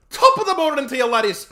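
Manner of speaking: put-on loud, high-pitched Irish accent. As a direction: belt it out high in a hammed-up Irish brogue. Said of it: "please say".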